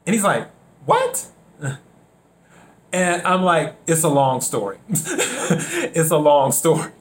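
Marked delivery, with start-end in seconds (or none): 0.9-1.3 high-pitched incredulous imitation; mock surprise. Caller hearing he's from South Carolina, mock surprise. Acting out caller's baffled reaction. 3.9-6.9 chuckling between repeats; amused. Real, not acted. Telling the caller about his accent, amused. Chuckling over retold phone exchange.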